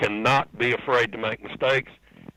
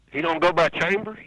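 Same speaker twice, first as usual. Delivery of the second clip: higher-pitched puzzled question; retold disbelief; 7 semitones higher than usual. Storyteller asking crewman about Lake's name, incredulous. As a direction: question it as if genuinely thrown.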